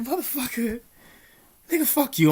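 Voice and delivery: high, loud imitated outburst; mock furious retort. Retold angry reply to inmate.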